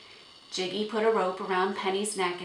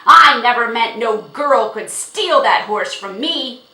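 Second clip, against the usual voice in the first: loud, raised, blustering shout; angry shouting; 5 semitones higher, 16 dB louder than usual. A narrator's reading. Horse owner refusing to give up horse, shouting. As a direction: shout it with furious scorn. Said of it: "Jiggy shouted".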